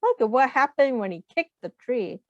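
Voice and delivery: ordinary delivery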